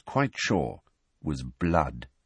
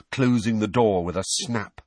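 ordinary delivery, nothing marked

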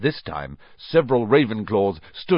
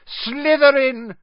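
very high, slow, loud cry; drawn-out high-pitched scream; screamed announcement; 14 semitones higher, 6 dB louder, slower than usual. A narrator's reading. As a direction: scream the verdict, stretching it out. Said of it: "when it screamed".

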